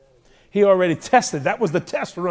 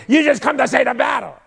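angry big-brother shout; brother yelling; 8 semitones higher, 6 dB louder than usual. Acted. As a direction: bark it at the little brother. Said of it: "his oldest brother goes".

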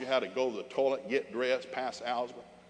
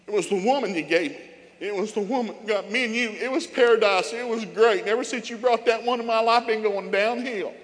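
loud, high comic outburst; hammed-up indignant excuse, pitched way up; frantic blame-shifting; 9 semitones higher, 10 dB louder than usual. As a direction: protest loudly like a man passing the blame. Adam blames Eve to God.

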